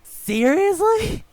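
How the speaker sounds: high, slow, stretched-out disbelief; incredulous. Real, not acted. Host reacts to cohost having nothing hype, stretched high disbelief. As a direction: drag the word out way up high in disbelief.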